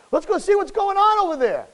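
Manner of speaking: mock excitement, high pitched; playful high-pitched suggestion; feigned excited curiosity. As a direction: act out an eager discovery. Same acted quote repeated.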